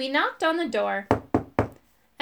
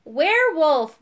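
loud, higher-pitched bellow; 5 semitones higher, 6 dB louder, slower than usual. A narrator's reading. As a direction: bellow it out as a firm confirmation. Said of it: "bellowed Irk".

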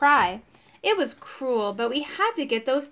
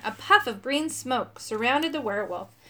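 plain reading voice

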